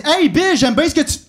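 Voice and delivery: high, loud fan greeting cut short; friendly passerby. Acted. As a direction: play an eager fan calling out, then breaking off. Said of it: "puis il m'a dit".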